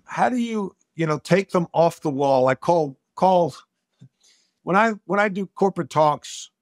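normal talking voice

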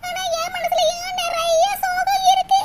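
high-pitched tearful lament, climbing as it goes; crying. Acted. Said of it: "மூசு மூசு மூசுன்னு அழுதுகிட்டே இருக்கா".

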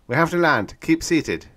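firm pilot calming the cabin; pilot's order. A narrator's reading. Pilot telling passengers to keep seated, firm.

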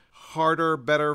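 ordinary delivery

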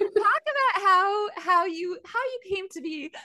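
high, laughing, slowed. Real, not acted. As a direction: giggle through the words, drawn out. Host laughing after guest laughs.

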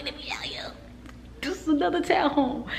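whisper. Real, not acted. Touring a model home quietly, whispered aside. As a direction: let me show you, breathed in a high whisper.

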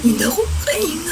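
high, soft, tearful choked plea; choking, can't breathe. Retold or imitated. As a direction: gasp it out as if your throat is closing. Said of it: "Yung boses ko parang". (parang umiiyak at hirap huminga, mataas at mahina)

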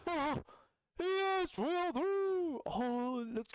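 gasp, then high exultant cries; high, elated whoop; triumphant. Real, not acted. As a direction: celebrate breaking through. Breaks through at last.

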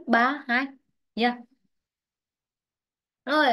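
ordinary delivery

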